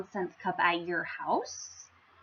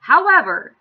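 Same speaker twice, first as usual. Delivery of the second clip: loud, lifted royal pause word; the king teasing a twist; dramatic king's however; 3 semitones higher, 11 dB louder than usual. Acted. King surprises the crowd with a loud however. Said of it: "said the king".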